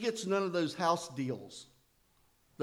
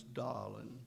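southern darling; 3 semitones lower, 7 dB quieter than usual. Retold or imitated. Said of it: "everybody was".

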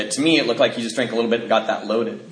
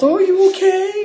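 very high, sing-song worried mother; concerned mom; 4 dB louder than usual. Retold or imitated. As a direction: coo it like a fussing mom. Mom voiced comforting.

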